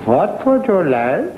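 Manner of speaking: high, slow, odd innkeeper voice; drawn-out host question with a rising end. Acted. Innkeeper asks the traveler for his order, high odd voice.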